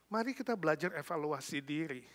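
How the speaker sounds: normal talking voice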